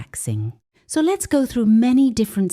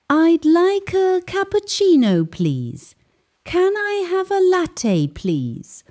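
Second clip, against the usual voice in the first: each word crisp and much higher, like a careful order; said clearly; 11 semitones higher, slower than usual. Shown as a demonstration. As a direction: order clearly so the barista hears. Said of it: "you can say clearly".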